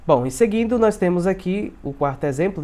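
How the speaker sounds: normal talking voice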